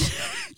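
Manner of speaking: breathy laugh. Real, not acted. Co-host laughs.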